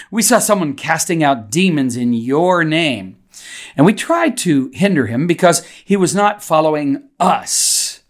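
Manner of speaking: "your name" and "us" leaned on with a pitch swing; deliberate emphasis. Shown as a demonstration. Preacher quoting John so listeners get it in advance, stressing "your" and "us". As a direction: punch "your" and "us" so they stand out. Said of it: "I put the emphasis on the right words there".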